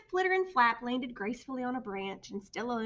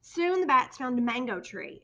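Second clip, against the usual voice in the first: usual speaking voice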